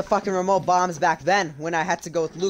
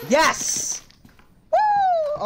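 loud triumphant yes and woo; excited cheer; 5 dB louder than usual. Real, not acted. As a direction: cheer the win at full voice. Gaming win celebration.

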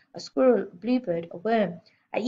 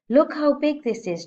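put-on higher, louder wide-eyed girl; excited young girl; amazed; 9 semitones higher, 6 dB louder than usual. A narrator's reading. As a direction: marvel at how big it is. Girl marvelling at size.

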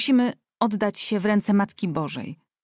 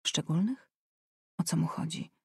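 puzzled echo; 5 dB quieter than usual. Acted. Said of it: "szczególnych uczuć".

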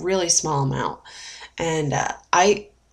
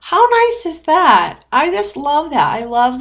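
gushing; 7 semitones higher, 4 dB louder than usual. Real, not acted. Gushing about husband's thoughtful dinner.

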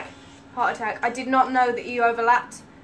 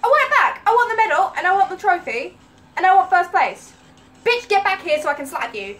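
high, loud indignant shouting; outraged yelling; 7 semitones higher, 7 dB louder than usual. Real, not acted. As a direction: demand it back in a shrieking tantrum. Loses first place, rages at rival.

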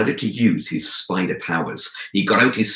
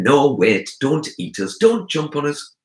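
urgent pleading; 7 semitones higher, 3 dB louder than usual. A narrator's reading. Anansi pleads with hungry panther.

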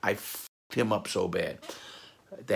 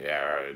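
low, drawn-out wordless grumble; low grumbling noise; imitated grumble; 5 semitones lower than usual. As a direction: imitate a man grumbling under protest. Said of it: "grumbles like that".

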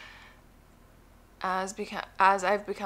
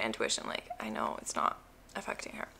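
whisper; 10 semitones lower, 11 dB quieter than usual. Real, not acted. Whispered aside.

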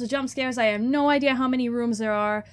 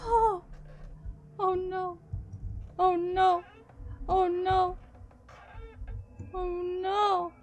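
anxious; 8 semitones higher, 4 dB quieter, slower than usual. Real, not acted. Repeated dread.

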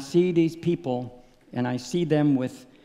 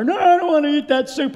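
much higher, louder mimicked protest; repeated child's refusal; 11 semitones higher, 6 dB louder than usual. Father imitating his daughter's second refusal of supper, high, loud whiny protest. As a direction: draw out a stubborn child's whine. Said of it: "I heard Sarah say again".

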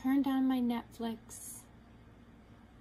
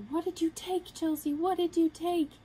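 higher, louder mock yell of a panicked mom; 6 semitones higher, 3 dB louder than usual. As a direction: imitate a scared mother yelling her question. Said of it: "she's yelling at me".

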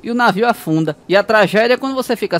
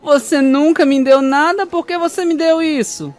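surprised mother-in-law; 9 semitones higher, slower than usual. Retold or imitated.

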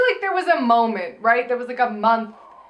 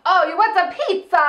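higher, punchy imitation Italian accent; mock Italian accent; 7 semitones higher, 4 dB louder than usual. Podcaster imitating Hayden Christensen's pizza line, hammed-up Italian accent. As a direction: exaggerate a New York Italian accent. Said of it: "really going for the Italian".

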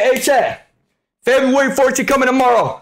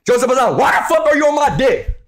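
ordinary delivery

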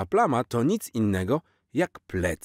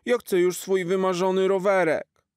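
put-on high, whiny child voice; a kid who can't wait for his bike; impatient little boy; 11 semitones higher, 4 dB louder than usual. Jaś at supper, impatient high child voice.

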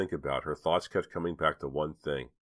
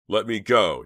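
loud, higher angry snap; 5 semitones higher, 9 dB louder, slower than usual. A narrator's reading. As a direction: snap it at the guard. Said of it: "Chuck snapped angrily".

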